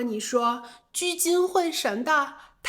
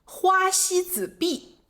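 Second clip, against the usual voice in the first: 花西子币 slow and higher, each syllable clear; slow, higher demo of the new term 花西子币; 7 semitones higher, slower than usual. Shown as a demonstration. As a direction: spell out 花西子币 slowly and higher. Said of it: "比如这个词".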